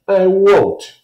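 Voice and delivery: put-on high, loud childish protest; Kirsty refusing her shoes, high loud sputter; sputtering little girl. A narrator's reading. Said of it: "Kirsty sputtered".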